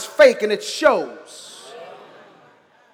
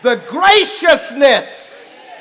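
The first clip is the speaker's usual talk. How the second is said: slow, loud preached graciousness; preaching; 2 semitones higher, 6 dB louder, much slower than usual. Shown as a demonstration. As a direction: call out The graciousness slowly. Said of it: "preached vs later spoken".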